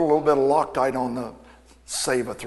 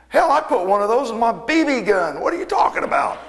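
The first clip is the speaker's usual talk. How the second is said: high, louder incredulous jab; mock disbelief; 9 semitones higher, 5 dB louder than usual. Needling the young mechanic about a helicoil, high and scoffing. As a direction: scoff at how basic it is.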